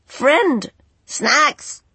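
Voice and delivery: high, loud squawks; agitated bird. A narrator's reading. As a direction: squawk it in excitement. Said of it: "agitated dance".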